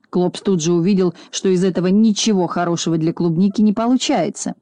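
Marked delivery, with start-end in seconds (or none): none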